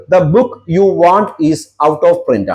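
very slow, word by word; slow example reading. Shown as a demonstration. Teacher reads the sample sentence to students, very slowly. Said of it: "for example".

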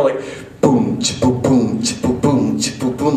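spoken drum sounds boom ch boom; vocal drum beat. Shown as a demonstration. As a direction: mouth a samba groove with boom and ch. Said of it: "did it kinda sound like".

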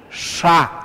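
"sha" with tongue tip curled near the palate; retroflex SH. Shown as a demonstration. Teacher showing where the tongue goes, retroflex SH. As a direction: curl the tongue back for a lone "sha". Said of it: "la punta de la lengua es curvada".

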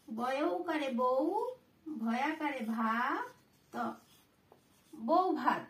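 patient teacher dictating the spelling; slow dictation. Shown as a demonstration. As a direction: spell it out slowly, letter by letter. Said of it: "ঠিক আছে".

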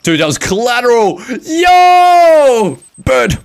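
loud, high bursts of excitement; excited shouting. Real, not acted. Player reacting to a collateral hit, excited shouting. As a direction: yell out at the shock of it. Excited calling out during game.

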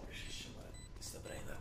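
soft breathy whisper; whispered aside. Real, not acted. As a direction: mutter it under the breath. Muttered aside after argument.

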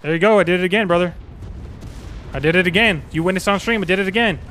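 loud, triumphant crowing; excited celebration. Real, not acted. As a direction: crow about it to the stream. Celebrating the repeated challenge.

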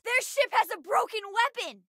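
high, urgent report; high-pitched combat cry; excited battle call-out. Acted.

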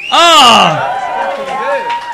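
cry out. Real, not acted. Comic cries out at the crowd.

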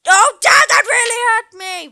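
put-on a boy wailing at his dad; mock-hurt child yell. Son wailing at his dad after getting hurt, a loud, mock-pained kid's yell.

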